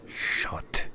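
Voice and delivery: hissed whispered order; whispered snap. Acted. Bald man silencing his partner, whispered snap. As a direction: cut him off under your breath.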